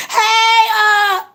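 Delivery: ordinary talk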